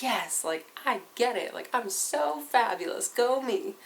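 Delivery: high, self-satisfied gloating; smug inner voice. Retold or imitated. Student gloating over classmates, mock smug and high. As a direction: crow about it like a proud know-it-all. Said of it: "and you're like".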